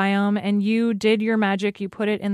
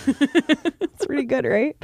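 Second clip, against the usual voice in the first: laughing; 3 semitones higher, slower than usual. Real, not acted. Laughing at own phrase.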